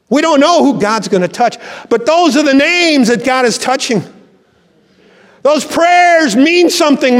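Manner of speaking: high, ringing preacher's voice, slowing on the key lines; rising pulpit fervor; fervent preaching. Acted. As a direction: preach it out to the congregation with full force. Preaching at full force.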